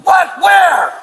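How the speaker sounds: shouting. Real, not acted. Confrontation, yelling at officers.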